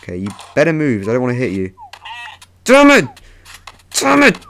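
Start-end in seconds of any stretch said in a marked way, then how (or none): 2.6-4.4 loud, very high outburst; frustrated shout. Real, not acted. Player hits the wrong thing in game, frustrated shout. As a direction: yell it out, high and sudden. Accident while fighting in game.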